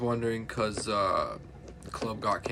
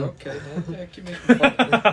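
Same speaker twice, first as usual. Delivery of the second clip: loud, much higher mock outrage at being teased; playful protest; 9 semitones higher, 13 dB louder, faster than usual. Real, not acted. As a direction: yell back at the teasing with a grin.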